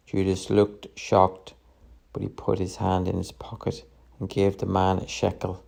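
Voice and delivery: ordinary delivery, nothing marked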